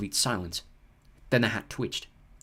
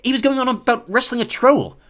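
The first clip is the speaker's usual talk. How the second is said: put-on higher, louder boyish grumble; young boy's voice; 12 semitones higher, 9 dB louder than usual. Ron complains to Harry about his brother, higher and louder. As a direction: complain like an indignant schoolboy.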